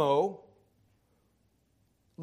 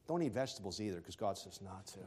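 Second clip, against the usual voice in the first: deadpan joke; 11 semitones lower, 13 dB quieter, faster than usual. Real, not acted. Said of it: "I'm kidding".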